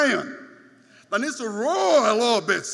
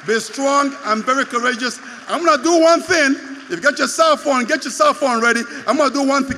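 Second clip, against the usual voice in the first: loud, shouted sermon climax; rally the whole church with a shout; full-force preaching; 8 dB louder, faster than usual. Acted. Pastor firing up Brooklyn church at the climax, shouting.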